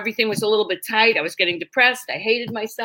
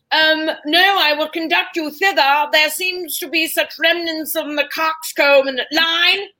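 posh English actor; 4 semitones higher than usual. Retold or imitated. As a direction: ham it up like a stuffy English stage actor. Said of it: "English actors were hysterical".

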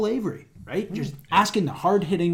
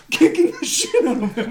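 laughing; 8 semitones higher than usual. Real, not acted. Laughing hard.

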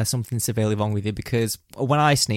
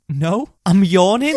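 loud, high, drawn-out mock protest; indignant protest; 7 semitones higher, 7 dB louder, slower than usual. Answering people who ask if he is crying, indignant. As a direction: deny it, exasperated, stretching the words.

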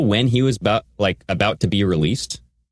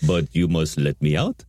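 low, put-on foreign-sounding voice; mock a captive striking a bargain, pitched low; imitated prisoner voice; 5 semitones lower than usual. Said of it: "He talks like this".

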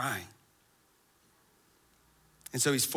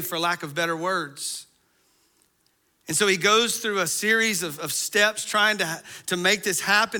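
louder, higher storytelling from the pulpit; fervent preaching; 5 semitones higher, 6 dB louder than usual. Acted. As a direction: drive the story forward with pulpit energy. Retelling David's plot with fervor.

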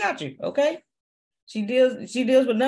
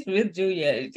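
normal talking voice

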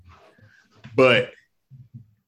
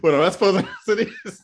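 laughing; 7 semitones higher, faster than usual. Real, not acted. Laughing at the reporter bit.

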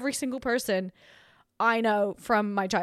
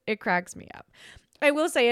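ordinary talk

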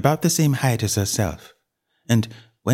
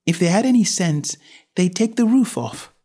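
Alice's voice; 9 semitones higher than usual. A narrator's reading.

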